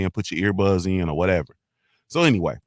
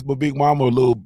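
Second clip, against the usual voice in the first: ordinary talk, nothing marked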